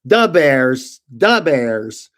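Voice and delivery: put-on loud Chicago-accent catchphrase; booming imitation of a Chicago accent; Chicago guy. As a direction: belt it out like a Chicago sports fan. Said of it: "he was the Chicago guy".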